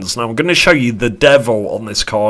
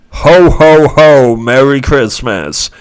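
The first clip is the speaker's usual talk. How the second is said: booming, much louder Santa "ho, ho, ho"; Santa Claus; 4 semitones higher, 8 dB louder, slower than usual. Pretend. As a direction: bellow it like Father Christmas. Santa laugh pun on hoe.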